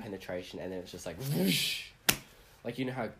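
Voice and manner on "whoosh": sound effect. Retold or imitated. Imitating a release.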